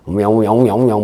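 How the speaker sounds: low droning imitation of a Buddhist chant; mock chanting hum. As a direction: hum it like a monotone sutra. (낮게 웅얼거리는 불경 흉내)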